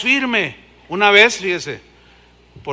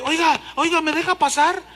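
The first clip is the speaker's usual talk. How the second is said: higher mock request to get by; pushy latecomer; 9 semitones higher than usual. Latecomer asking to get past weeping worshippers, higher voice. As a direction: butt in during worship.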